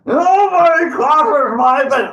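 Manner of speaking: high, loud, drawn-out troll shout; indignant troll bellow. Acted. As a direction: bellow slowly, stretching the word nobody. Troll insists nobody crosses.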